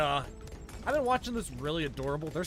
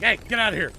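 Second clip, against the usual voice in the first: louder, barking at the fox; mock gruff shout; 5 semitones higher, 10 dB louder, faster than usual. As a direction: shoo it away roughly. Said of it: "it sounds like he's like".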